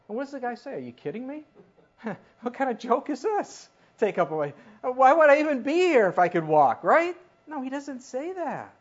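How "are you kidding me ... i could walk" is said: incredulous protest. Acted. Preacher voices the healed man's protest.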